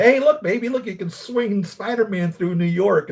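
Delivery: high, loud, eager sales pitch; acting out an excited "look at this!"; excited pitch to partner. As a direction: show it off to her with big enthusiasm. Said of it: "that I can say".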